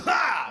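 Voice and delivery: short high loud "Ah!" instead of crying; bottled-up yelp. Retold or imitated. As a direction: choke the tears into one sharp cry. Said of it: "I would just be like".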